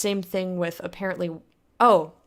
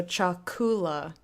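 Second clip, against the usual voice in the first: "chakula" slowly, stressing ku; second-to-last syllable stress; slower than usual. Shown as a demonstration. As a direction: lengthen the ku in "chakula". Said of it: "emphasis goes on the second to last syllable".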